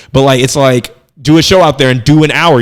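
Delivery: ordinary talk, nothing marked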